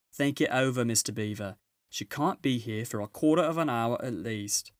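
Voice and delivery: a brisk, busy housewife giving orders; Mrs Beaver. Acted.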